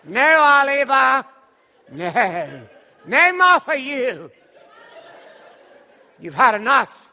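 very loud, high mock-British bellow; hammed-up Dickensian master refusing an orphan; Dickens villain. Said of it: "I felt like I was in the Charles Dickens play".

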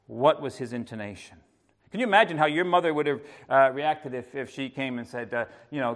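usual speaking voice

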